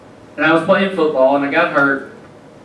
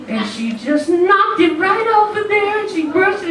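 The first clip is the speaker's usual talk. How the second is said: sweet Southern mom. Retold or imitated. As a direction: tell it in the mother's singsong high pitch.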